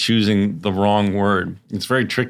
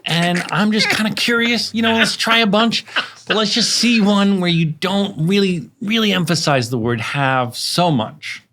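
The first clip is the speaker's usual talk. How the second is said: higher, coaxing director voice; mock gentle director; 9 semitones higher than usual. Host playing a director tiptoeing around an actor's line reading. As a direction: coax him gently like a careful director. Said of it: "Hey, young little Donald".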